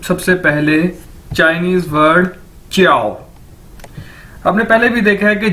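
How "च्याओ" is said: "च्याओ" run together as chyao, starting with a ch sound; model pronunciation. Shown as a demonstration. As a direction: say "च्याओ" as a teacher's model word, ch-yao. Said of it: "इसको कैसे बोलेंगे".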